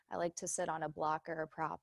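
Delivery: usual speaking voice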